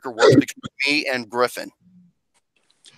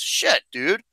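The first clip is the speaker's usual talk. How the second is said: normal talking voice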